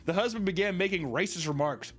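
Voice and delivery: normal talking voice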